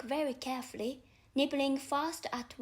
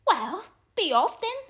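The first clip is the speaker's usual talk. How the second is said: louder, sulky dismissal; sulky pigeon sending Alice away, louder; 5 semitones higher, 10 dB louder than usual. A narrator's reading. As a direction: shoo her off in a huff. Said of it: "in a sulky tone".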